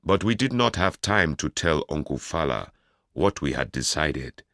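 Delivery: plain reading voice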